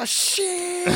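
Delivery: high, yelped cartoon cry; falling man's cry. Retelling the PSA clip of a man falling, a high yelp. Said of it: "he's just like".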